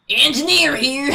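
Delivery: slow, drawn-out game character impression; Engineer. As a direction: play the slow-talking Texan game engineer. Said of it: "do any T F two voices".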